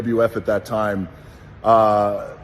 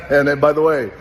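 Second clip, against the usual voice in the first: laughing; 4 semitones higher, 5 dB louder than usual. Real, not acted. Laughing after the impression.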